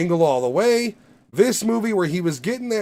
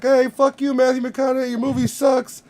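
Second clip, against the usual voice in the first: mock heckling yell; 9 semitones higher than usual. Ralphie May heckling McConaughey from his apartment, mock yell. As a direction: jeer it up at the garage like a heckler. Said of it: "Ralphie May every day would just be like".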